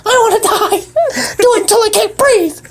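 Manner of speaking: high bird voice breaking into laughs; laughing squeaky bird. Acted. Bird PD begs Lester to choke him, high giggly voice.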